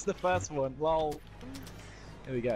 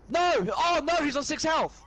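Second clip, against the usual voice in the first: high, loud cry of dismay; real gamer outburst; dismayed yell; 11 semitones higher, 5 dB louder than usual. Real, not acted. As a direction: yell as your target slips away. Target escapes on low health.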